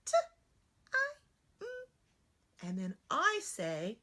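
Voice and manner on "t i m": little student voice. Acted. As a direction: sound it out like a small kid. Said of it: "Student says".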